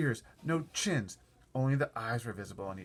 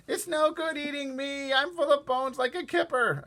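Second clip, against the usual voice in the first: a lightly pitched-up, pleading creature; high character voice; 6 dB louder than usual. Acted. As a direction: plead not to be eaten. Character pleads not to be eaten.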